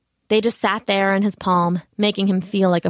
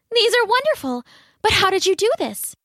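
put-on much higher little girl voice; sweet young daughter calling her father; 11 semitones higher than usual. A narrator's reading. Young daughter speaks to king.

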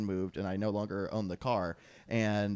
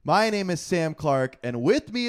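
big, slow announcer welcome; podcast host intro; 5 semitones higher, 8 dB louder, slower than usual. Acted. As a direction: open the show like a radio host. Podcast intro, music under.

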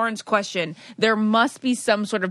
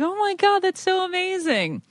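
high gushing voice; mock excited gush; 11 semitones higher than usual. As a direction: gush like an overexcited husband. Mocking husband's excited call.

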